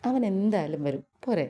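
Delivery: usual speaking voice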